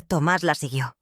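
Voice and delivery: ordinary delivery, nothing marked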